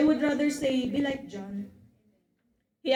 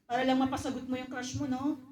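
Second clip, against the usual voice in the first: normal talking voice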